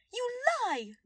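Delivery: high, loud furious shout; shouted in rage. A narrator's reading. Cornelia accusing Lord Clemen, furious shout. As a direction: hurl the accusation, red with rage. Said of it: "Cornelia shouted".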